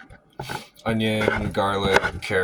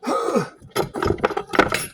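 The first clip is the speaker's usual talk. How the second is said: sharp gasp. Real, not acted. Eyes stinging from onions.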